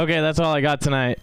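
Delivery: ordinary talk, nothing marked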